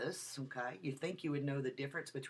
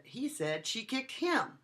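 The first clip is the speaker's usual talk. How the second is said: higher and slow, ending on him with a strong fall; stress on HIM; 9 semitones higher, 4 dB louder, slower than usual. Shown as a demonstration. Lecturer demonstrating stress on him to students. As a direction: land hard on him at the end. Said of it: "I changed the emphasis of the word".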